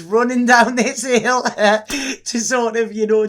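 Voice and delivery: laughing out loud through the story; can't help cracking up mid-sentence. Real, not acted. Laughing while retelling film scene.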